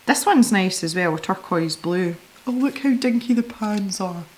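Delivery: usual speaking voice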